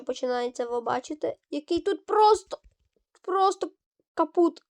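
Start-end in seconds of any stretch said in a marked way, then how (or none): 1.5-4.6 mock despair. Real, not acted. Reacting to the hard part.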